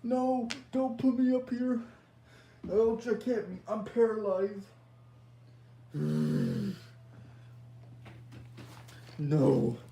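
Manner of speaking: loud, frightened begging; desperate pleading. Acted. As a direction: beg the killer for your life. Victim pleading with chainsaw killer.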